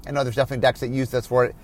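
ordinary delivery

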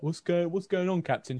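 nosy soldier calling out; rowdy soldier. Acted. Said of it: "all of them are like".

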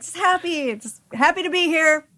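high, bright-eyed and happy to be here; chirpy happy-go-lucky kid voice; cheerful happy character. Pretend.

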